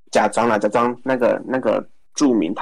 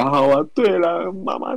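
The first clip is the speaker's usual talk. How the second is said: mock wailing; 7 semitones higher than usual. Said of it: "放声痛哭的".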